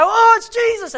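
loud, high exclamation; astonished recognition. Acted. Disciples realising it is Jesus, astonished cry.

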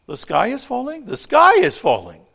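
high, loud, rising to a screech; panicked shout. Acted. Chicken Little shrieks the news to the world, panicked shout. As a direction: yell it in total panic. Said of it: "shouts Chicken Little".

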